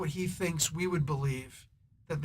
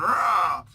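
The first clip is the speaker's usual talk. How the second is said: loud, short mock roar; playful spoken "rah" roar; imitated monster roar; 7 dB louder than usual. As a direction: roar like a young Hulk coming to. Imitates young Hulk coming around.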